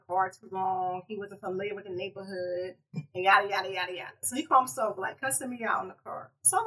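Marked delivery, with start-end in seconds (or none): none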